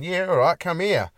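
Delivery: imitating the guy. The Leia guy agreeing to share his scroll, cheerful mock voice. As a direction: play the costumed bloke cheerfully agreeing. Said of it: "he would have gone".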